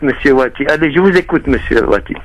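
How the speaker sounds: usual speaking voice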